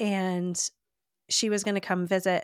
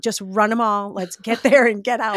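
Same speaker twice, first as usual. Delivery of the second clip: panicked urging; 2 semitones higher, 8 dB louder than usual. Retold or imitated. To the driver at midnight, frightened urgent loud urging. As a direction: hurry them through the city at midnight. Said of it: "It was so scary".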